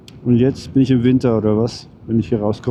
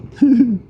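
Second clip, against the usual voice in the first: sudden high laugh; high burst of laughter; 12 semitones higher, 4 dB louder than usual. Real, not acted. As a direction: laugh out loud in disbelief. Laughs at rain after tunnel.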